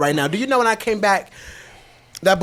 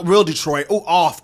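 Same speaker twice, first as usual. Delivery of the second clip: normal talking voice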